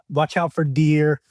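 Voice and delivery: slow, stretched imitation North Dakota accent; [name]'s Fargo accent. Asking the host to guess the accent, imitated Fargo accent. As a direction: mimic a Fargo accent, drawing out the vowels. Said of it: "you know what accent that is".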